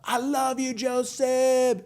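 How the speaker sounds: high, drawn-out imitation of a fan's shout; mock fan yell. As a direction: call up to the stage like an adoring fan, without full volume. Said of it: "He just yells up".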